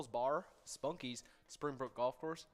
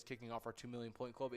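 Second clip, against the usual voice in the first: ordinary talk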